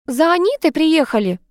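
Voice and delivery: high-pitched announcing call. A narrator's reading. Woman announcing the suitor's arrival, calling out. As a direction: call it out, not at full volume. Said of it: "закричал женский голос".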